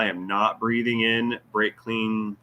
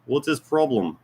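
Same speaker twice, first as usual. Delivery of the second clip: higher, put-on Russian accent; 5 semitones higher than usual. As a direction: ask it like a mock Russian. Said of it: "I say this in Russian accent voice".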